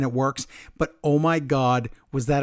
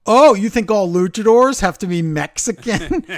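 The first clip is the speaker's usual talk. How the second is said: higher, louder mock indignation; retold indignant challenge; 5 semitones higher, 7 dB louder than usual. Retelling a commentator challenging his partner, indignant. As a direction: throw the accusation back at him. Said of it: "Graves is like".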